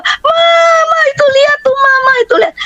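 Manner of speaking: very high imitated shrieking; retold child's frightened yelling; child's alarmed scream. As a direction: cry out to mum in alarm, high-pitched. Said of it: "dia teriak".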